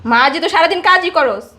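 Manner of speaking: loud, high-pitched mocking scorn; scornful. Sneering at the servant's claim to work all day, loud scorn. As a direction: throw it back loudly in mockery.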